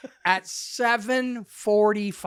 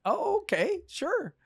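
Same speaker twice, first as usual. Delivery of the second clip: higher, knowing mock agreement; a hint of sarcasm; sarcastic okay; 11 semitones higher, 4 dB quieter than usual. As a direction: lilt it, not buying it. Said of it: "And I'm like".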